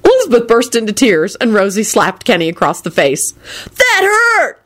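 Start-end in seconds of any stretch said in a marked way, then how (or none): 3.8-4.6 high, wounded cry; high-pitched whiny outrage; angry howl. A narrator's reading. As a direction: howl in protest at a slap. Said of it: "Kenny howled angrily".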